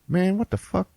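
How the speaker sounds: high-pitched, put-out protest; mock indignant complaint. Brother handed the wrong soda, mock indignant. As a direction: imitate a disgusted gripe.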